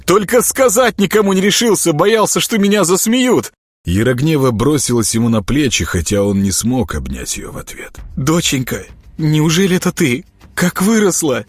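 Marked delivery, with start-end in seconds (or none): 0.1-3.5 high, eager outburst; excited boy. Acted. Boy boasting he recognized his sister first, excited and high. 8.2-11.4 higher, rising wonder; a mother unable to believe her eyes; amazed disbelief. A narrator's reading. Mother recognizing her grown daughter, amazed. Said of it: "не могла поверить глазам Верхуслава".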